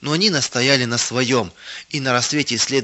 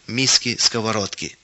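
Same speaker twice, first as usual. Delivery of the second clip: usual speaking voice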